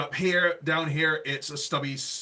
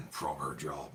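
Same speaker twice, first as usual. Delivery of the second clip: put-on West Country accent; 10 semitones lower, 8 dB quieter than usual. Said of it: "talking like a pirate".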